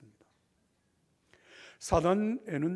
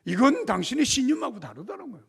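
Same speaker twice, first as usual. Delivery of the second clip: higher, louder scolding; angry ranting general; 9 semitones higher, 8 dB louder than usual. Retold or imitated. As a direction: make a fuss at him. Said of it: "막 이러면서 야단 법석을 치는데".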